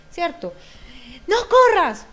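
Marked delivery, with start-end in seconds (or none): 1.3-2.1 high, loud warning shout; sharp yell. Retold or imitated. Parent shouting at a running child, loud and high. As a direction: yell it across the room.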